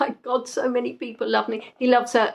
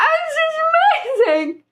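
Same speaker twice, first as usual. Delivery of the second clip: gushing delight. Retold or imitated. Recalling her reaction to the romantic film line, squealing. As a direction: squeal it like the best news ever. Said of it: "And I was like".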